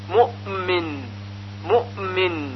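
مؤمن with a slight release into the m; soft mu'min, humming straight out of the hamza; near-qalqalah hamza. Shown as a demonstration. Teacher showing the exception, almost bouncing hamza in مؤمن. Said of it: "همزه یک حالتی تقریباً شبیه به قلقله".

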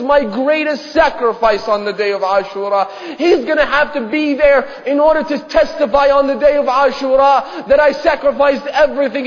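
loud, high, impassioned preaching voice; anguished father's declaration. Acted. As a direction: cry out the father's refusal with full fervor. Father explains the sacrifice to daughter.

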